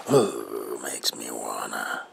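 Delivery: ordinary delivery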